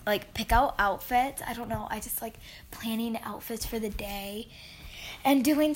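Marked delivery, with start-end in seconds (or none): 1.5-4.5 whispering. Real, not acted. Quiet aside about outfits.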